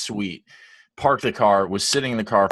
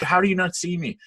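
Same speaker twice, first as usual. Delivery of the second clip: retold screaming; 9 semitones higher than usual. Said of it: "I'm, like, screaming".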